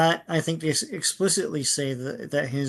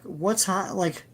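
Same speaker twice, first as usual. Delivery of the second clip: normal talking voice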